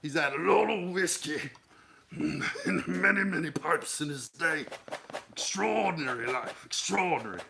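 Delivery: whiskey-soaked British businessman, chortling; fat Victorian British businessman. Acted. Describing a man of whiskey and pipes, hammed-up British businessman. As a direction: huff it out like an old British gent. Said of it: "a fat nineteenth century British businessman".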